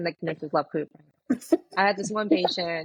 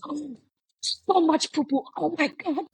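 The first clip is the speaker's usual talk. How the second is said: high, drawn-out mock amazement; imitated overwhelmed patient; 9 semitones higher, slower than usual. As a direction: play the stunned patient, pitched way up. Acts out patient after enema.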